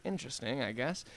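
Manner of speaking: ordinary talk, nothing marked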